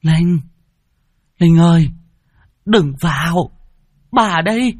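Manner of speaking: high, urgent, drawn cries of warning; grandmother calling out. Acted. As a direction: call the child back loudly. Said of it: "tiếng của bà nội kêu lên".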